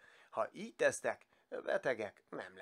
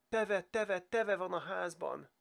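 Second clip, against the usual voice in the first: high frightened cry of a little bunny; crying out in fright; 7 semitones higher, 3 dB louder, slower than usual. Acted. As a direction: wail out in panic, tearful. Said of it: "sírva kiáltott fel". (sírva, rémülten kiáltva)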